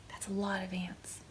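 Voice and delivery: soft whispered aside. Acted. Reader whispering a fun fact to the child. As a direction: lean in and whisper it like a secret.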